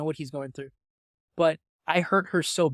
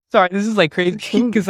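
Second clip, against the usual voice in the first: loud laugh through the words; laughing in disbelief; 3 semitones higher, 10 dB louder, faster than usual. Real, not acted. Laughs while apologising.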